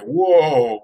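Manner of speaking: amazed. Retold or imitated. Man amazed at the dolphin that saved him, amazed. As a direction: let out a big awed whoa.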